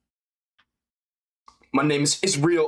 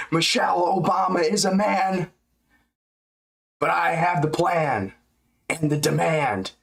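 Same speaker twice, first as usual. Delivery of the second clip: Hulk rhyme voice; 3 semitones higher than usual. Pretend. Rapping corny rhymes for chat, hammed-up Hulk voice. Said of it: "Make up some corny ass Incredible Hulk rhymes".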